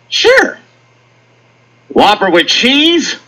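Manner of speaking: high squeaky sure, then slow repeat; mic guy taking the order; drive-thru cashier. Retold or imitated. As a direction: cashier voice over the speaker.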